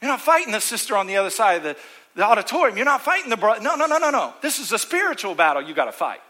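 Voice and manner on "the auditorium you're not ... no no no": ordinary talk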